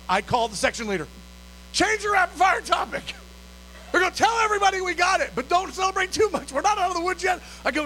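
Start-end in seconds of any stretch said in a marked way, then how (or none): 1.7-7.4 high, keyed-up announcement; urgent excited orders. Retold or imitated. Calling the section leader about the loan, urgent excitement. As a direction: relay it high and breathless with news.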